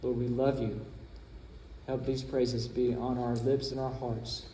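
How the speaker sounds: low, hushed pastoral prayer; closing prayer. Real, not acted. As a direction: pray softly with long pauses between phrases.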